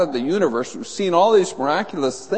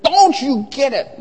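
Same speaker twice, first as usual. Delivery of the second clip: slow, much higher and louder exasperated rebuke; 10 semitones higher, 4 dB louder, slower than usual. Retold or imitated. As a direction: press it home, exasperated. Jesus rebuking them again.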